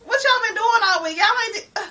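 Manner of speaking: high, exasperated questions at the family; retold outrage. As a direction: squeal it up high like walking into a mess. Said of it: "I'm like".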